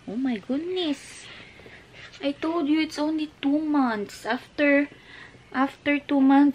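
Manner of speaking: high, drawn-out comforting parent voice; sing-song soothing explanation. Real, not acted. Parent reassuring crying child about classmates.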